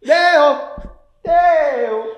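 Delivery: loud high call. Pretend. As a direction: call across the room high. Calling out in game.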